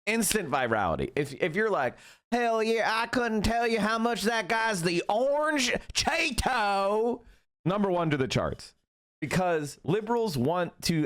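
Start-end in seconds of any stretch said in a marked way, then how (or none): 2.3-7.2 high, slow, put-on Southern twang; mock Southern drawl. Streamer mocks a Southern liberal-pleaser in a slow Southern drawl. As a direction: ham up a good-ol'-boy Southern drawl. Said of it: "if you have a Southern drawl".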